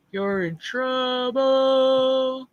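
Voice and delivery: long, loud, gloating singsong; taunting. Retold or imitated. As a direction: stretch it out like a playground warning. Taunting a scammer in retold warning.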